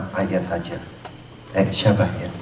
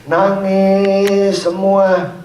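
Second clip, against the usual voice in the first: high, drawn-out mock wail; mock crying; 10 semitones higher, 5 dB louder, much slower than usual. Preacher mimicking the mourners crying, high drawn-out wail. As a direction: stretch it out like weeping.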